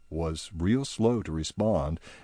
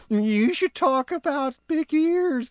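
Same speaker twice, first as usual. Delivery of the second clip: high teasing giggly voice; Barnaby teasing High, giggling high voice; giggling elf; 3 dB louder than usual. Acted. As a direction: tease him about his ears. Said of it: "giggled Barnaby".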